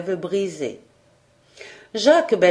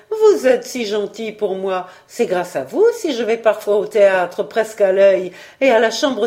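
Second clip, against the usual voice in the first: effusive, gushing housekeeper; chatty housekeeper, eager. Acted. Housekeeper grateful to her generous employer, eager and effusive. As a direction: pour out thanks with lively emphasis.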